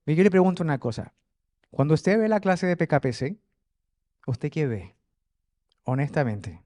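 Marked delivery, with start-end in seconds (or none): none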